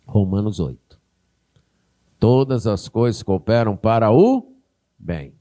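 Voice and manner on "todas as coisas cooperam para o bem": ordinary delivery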